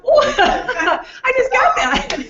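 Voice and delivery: words squeezed out through a high laugh. Real, not acted. Laughing at the accent reveal.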